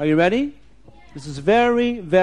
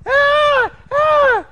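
high, loud pretend shriek; two short piercing screams, acted out; storyteller imitating a frightened scream; mock scream; 8 dB louder than usual. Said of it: "she starts to scream".

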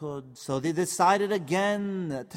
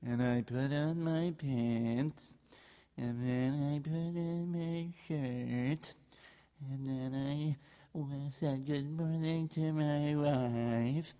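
put-on soft, slow, drowsy old man; sleepy old rabbi; 3 semitones lower, 5 dB quieter, much slower than usual. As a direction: mumble as if just woken.

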